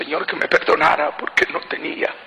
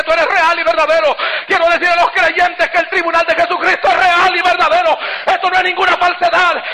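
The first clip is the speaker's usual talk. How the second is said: preaching at full force; 5 semitones higher than usual. Acted. Preacher pleading with the Lord to return, full force preaching. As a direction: build it up like a revival sermon climax. Testimony builds to pleading before God.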